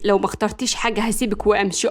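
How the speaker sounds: usual speaking voice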